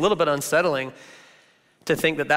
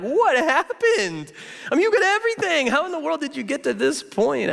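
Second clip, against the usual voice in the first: stunned disbelief; 10 semitones higher than usual. Retold or imitated. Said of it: "And you're like".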